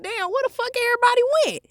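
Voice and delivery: sudden shock at being left alone; startled. Retold or imitated. Realizing friends left her.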